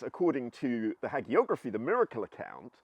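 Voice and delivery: ordinary delivery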